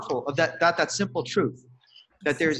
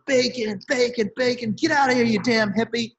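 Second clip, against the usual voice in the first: imitated yelling; 6 semitones higher, slower than usual. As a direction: bark it like the diner who heckled her. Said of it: "yelling, like".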